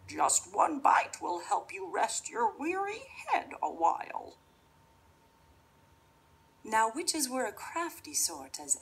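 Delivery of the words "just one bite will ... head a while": witch voice. Acted. Witch coaxing Rosaline.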